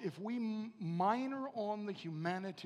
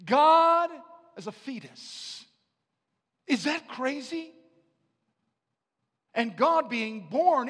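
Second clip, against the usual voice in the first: loud, higher, rising preacher's fervour; preaching at full force; 6 semitones higher, 15 dB louder than usual. Acted. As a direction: preach it out to the congregation with fire. Preaching climax on God's humanity.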